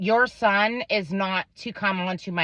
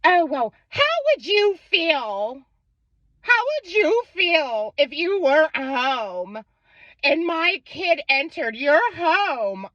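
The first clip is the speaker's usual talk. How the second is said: higher, whiny mock-indignant voice; mocking the other mom; 8 semitones higher than usual. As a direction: parody the offended mother's complaint. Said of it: "she's like".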